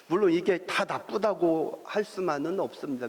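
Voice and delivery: ordinary talk, nothing marked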